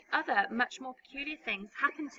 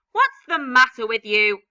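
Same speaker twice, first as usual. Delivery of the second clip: put-on loud sharp screech at the boy; loud screeching aunt; 11 dB louder than usual. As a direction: screech it at him. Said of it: "Aunt Spiker screeched".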